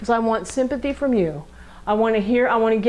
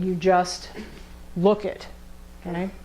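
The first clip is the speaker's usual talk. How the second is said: ordinary talk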